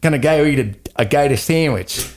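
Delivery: lightly put-on Australian accent, with a laugh; mock Australian accent. Friend dared on air to do an Australian accent, half-laughing. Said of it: "Go for it".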